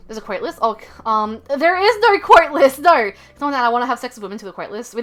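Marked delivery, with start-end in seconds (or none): 1.6-3.1 emphatic denial. Real, not acted. Emphatic denial of a chat question.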